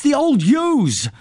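high, drawn-out urgent cry; distressed child, acted; gasped. A narrator's reading. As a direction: get it out in a stretched, high voice. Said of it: "I gasped".

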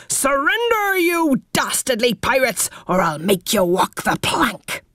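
high heroic pirate shout; pirate hero roar. A narrator's reading. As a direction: roar like a swashbuckling captain. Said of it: "leapt on the toy chest and roared".

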